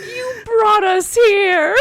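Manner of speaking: slow, high, laughing complaint; indignant and cracking up; laughing protest. Real, not acted. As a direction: drag it out in mock outrage.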